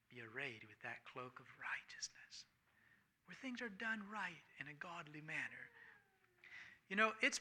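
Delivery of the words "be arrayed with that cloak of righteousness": preacher's whisper. Real, not acted. Preacher whispering to the congregation, hushed. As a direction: drop to a hushed, breathy whisper. Preacher drops to intimate aside.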